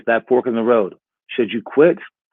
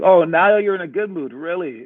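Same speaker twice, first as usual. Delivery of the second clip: funny, teasing dad; 6 semitones higher than usual. Retold or imitated. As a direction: playfully tease a child, imitating dad. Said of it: "he was just, like, so, like, funny about it".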